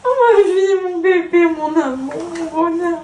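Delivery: doting mother cooing; tender cooing. Real, not acted. Mother cooing to anxious daughter.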